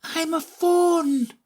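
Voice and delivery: high, slow, joyful fawn cry; delighted fawn. A narrator's reading. Fawn realizing what it is, high and delighted. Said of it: "it cried out in a voice of delight".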